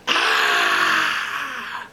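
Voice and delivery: high piercing wail; high frustrated shriek. A narrator's reading. Phantom tearing his clothes, a high shriek. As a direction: shriek like a man losing it. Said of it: "he tears his clothes and goes".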